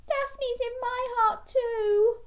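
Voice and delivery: very high, slow squeaky wail; storybook tearfulness, held high and drawn out; tearful little fish. Acted. Rita remembering Daphne with Gilbert, high mock-tearful voice.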